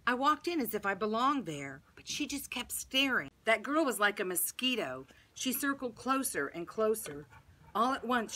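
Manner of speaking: ordinary delivery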